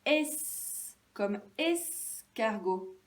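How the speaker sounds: "S, comme escargot" slow, each es held; first syllable isolated. Shown as a demonstration. Teacher asking for the matching picture, first syllable isolated. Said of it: "qui commençait par".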